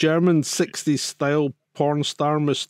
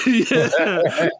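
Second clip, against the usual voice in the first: ordinary delivery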